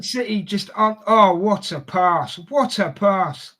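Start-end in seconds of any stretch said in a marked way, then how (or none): 1.1-3.6 slow, stretched words of praise; drawn-out admiration. Real, not acted. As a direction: savour each word, marvelling at the play. Live reaction to a fine pass.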